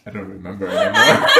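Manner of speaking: ordinary talk, nothing marked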